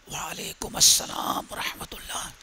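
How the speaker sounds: unvoiced whisper, barely there. Companion whispering his reply behind the door. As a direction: hush the reply so it can't carry.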